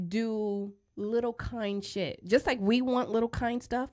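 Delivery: ordinary talk, nothing marked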